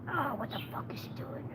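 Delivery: a retold shocked whisper; hushed and disbelieving, under the breath. Friend whispers in shock at her eager greeting. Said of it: "All I P two was like".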